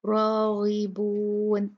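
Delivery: slow chanted रागिबून with full ra; heavy ra recitation. Shown as a demonstration. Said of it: "रा heavy होगा". (भारी रा के साथ धीमी तिलावत)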